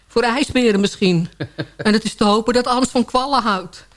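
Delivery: usual speaking voice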